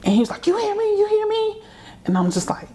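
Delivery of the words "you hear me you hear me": high yelled demand; mock yell. Father yelling at mom as he storms off, high and shrill. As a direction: shriek it up high.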